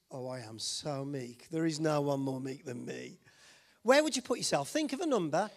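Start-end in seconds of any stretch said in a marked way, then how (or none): 0.1-3.2 mock meekness. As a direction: play someone lowly and proud of it. Mock self-declared perfectly meek person.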